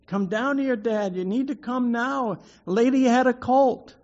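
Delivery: put-on high, urgent child's call upstairs; excited yell to dad. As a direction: shout up to dad that the foal is born. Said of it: "and I yelled up at my dad".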